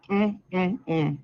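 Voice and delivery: ordinary delivery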